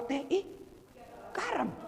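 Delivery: high "ih, garam" in disgust; mock disgust at tasting salt; disgusted surprise. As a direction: recoil at the salty tea.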